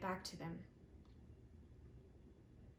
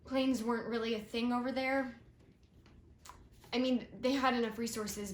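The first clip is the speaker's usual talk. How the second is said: pushing the words out, louder and higher; earnest, louder build; emphatic and louder; 3 semitones higher, 7 dB louder than usual. Acted. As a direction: lean hard into every word. Telling of the journey, emphatic.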